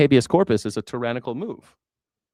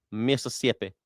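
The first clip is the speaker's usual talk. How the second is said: usual speaking voice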